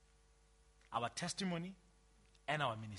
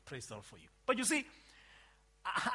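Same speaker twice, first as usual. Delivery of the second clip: ordinary talk, nothing marked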